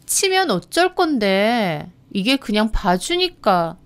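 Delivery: slow, drawn-out challenge; scornful, threatening drawl; taunting menace. Acted. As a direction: dare them, dragging the words. Bully taunts while shoving victim.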